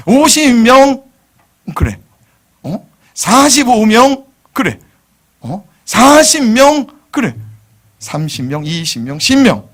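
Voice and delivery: high, loud and slow; mock haggling. Pastor replaying Abraham's haggling, high and loud. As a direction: play the cheeky bargainer.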